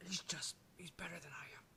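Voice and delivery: put-on hushed drunk confession; whisper. Skag confides to Rev in a whisper. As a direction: whisper it like a secret.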